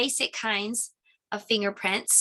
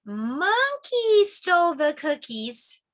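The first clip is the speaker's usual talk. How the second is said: slow, higher, louder announcement; dramatic reveal; 8 semitones higher, 4 dB louder, much slower than usual. Real, not acted. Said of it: "dramatic clue reveal".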